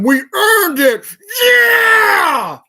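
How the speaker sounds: very high, loud, drawn-out shout; over-the-top imitation of a boastful ad; mock triumphant yell. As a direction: scream the boast in hammed-up mockery. Mocking the ad's boastful slogan.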